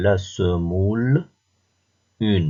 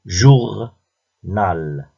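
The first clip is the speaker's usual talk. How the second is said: "Journal" split into jour and nal, loud first syllable; 5 dB louder than usual. Shown as a demonstration. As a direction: say "Journal" slowly with a clear pause between its two syllables. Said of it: "repère le son qui se répète".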